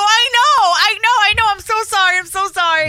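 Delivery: high, loud teary apologies; repeated tearful sorry, voice up high; near tears. Retold or imitated. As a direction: beg forgiveness almost crying. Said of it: "on the verge of tears".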